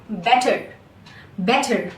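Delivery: "better" with the final R sounded; American better. Shown as a demonstration. Teacher showing American pronunciation, R sounded. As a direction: end "better" on a clear R. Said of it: "in America".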